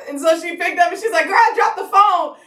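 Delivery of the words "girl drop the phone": high-pitched, slow, stretched exclamation; mom's excited reaction. Retold or imitated. Mom hears her daughter is pregnant, high excited exclamation. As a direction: imitate a thrilled mom, drawing it out.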